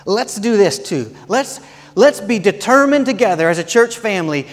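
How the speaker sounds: rally the church with a shout; fired-up pulpit call; full-force preaching. Acted.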